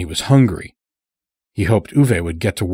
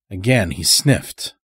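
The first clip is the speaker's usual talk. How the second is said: ordinary delivery, nothing marked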